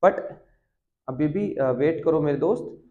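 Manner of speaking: usual speaking voice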